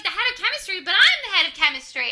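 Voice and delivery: high-pitched, quickening mock protest; indignant surprise. Doctor learns of new head of chemistry, indignantly surprised. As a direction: imitate affronted disbelief, voice jumping up. Retold man learns he is replaced.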